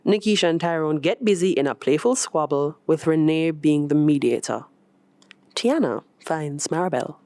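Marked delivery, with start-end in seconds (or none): none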